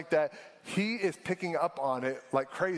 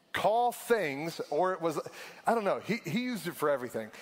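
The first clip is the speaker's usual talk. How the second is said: ordinary talk, nothing marked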